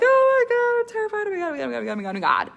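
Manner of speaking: hammed-up fright building down; mock terror. Shown as a demonstration. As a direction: act out being terrified, fading to muttering. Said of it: "So I'm sitting there and I'm like".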